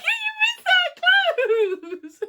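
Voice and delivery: very high, slow, laughing. Real, not acted. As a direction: squeal through laughter. Throwing game.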